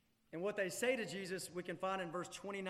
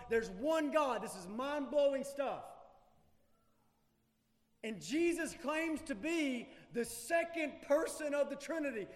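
forceful preaching; 5 semitones higher, 5 dB louder than usual. Real, not acted.

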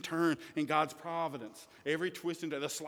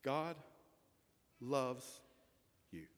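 quiet and slow; 7 semitones lower, 12 dB quieter, slower than usual. Real, not acted. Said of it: "it can be summarized in three words".

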